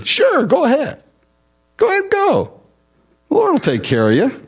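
drip with fake encouragement; facetious. Prophet mocking the king, high sarcastic cheer. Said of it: "facetious here".

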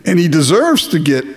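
ordinary talk, nothing marked

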